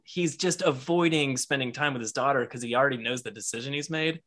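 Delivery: ordinary delivery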